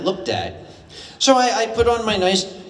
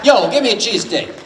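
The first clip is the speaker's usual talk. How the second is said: Philly order; 3 semitones higher, 5 dB louder, faster than usual. Pretend.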